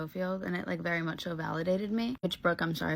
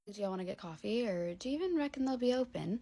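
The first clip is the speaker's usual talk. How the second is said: put-on British accent, lightly done; British woman; 3 semitones higher than usual. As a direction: play a fully British woman. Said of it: "a fully British woman".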